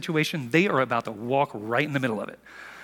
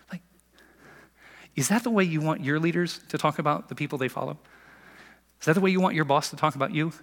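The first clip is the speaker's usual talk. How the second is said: normal talking voice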